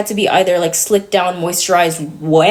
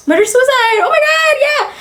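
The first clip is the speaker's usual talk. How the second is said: shocked outburst. Real, not acted. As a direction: blurt it out, shocked at yourself.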